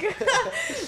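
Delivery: squealing high laugh; high burst of laughter. Real, not acted. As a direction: break into a giggle. Laughs at own joke.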